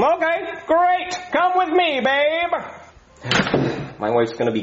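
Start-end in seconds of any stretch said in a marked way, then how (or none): 0.0-2.5 high, smooth-talking showbiz agent; oily Hollywood agent pitch; schmoozy hollywood agent. Acted. Agent takes wife away.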